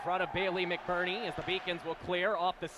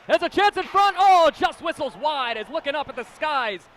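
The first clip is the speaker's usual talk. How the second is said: excited play-by-play; 6 semitones higher, 8 dB louder than usual. Real, not acted. Near miss in front.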